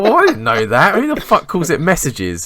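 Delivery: high, loud, laughing disbelief; baffled outrage. Retold or imitated. Dan to coworker, loud baffled laughing squeal. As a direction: squeal it out in shocked confusion. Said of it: "And I was like".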